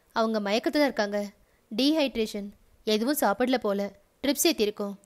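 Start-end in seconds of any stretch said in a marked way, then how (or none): none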